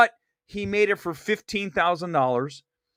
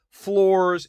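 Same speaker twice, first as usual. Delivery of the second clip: normal talking voice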